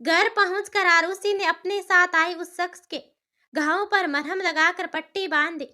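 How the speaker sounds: plain reading voice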